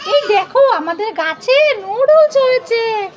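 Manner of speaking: put-on high, slow showing-off kid voice; proud child. Child showing mummy noodles on the tree, high and proud.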